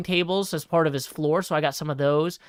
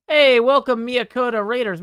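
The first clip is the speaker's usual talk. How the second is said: excited greeting; 6 semitones higher, 7 dB louder than usual. Real, not acted. Energetic intro greeting.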